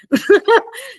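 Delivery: laugh. Real, not acted. Laughing at aunts' warning.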